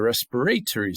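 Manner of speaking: respiratory as res-pi-RAY-tuh-ree; British-style "respiratory", RAY stressed; UK pronunciation. Shown as a demonstration. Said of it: "in the United Kingdom".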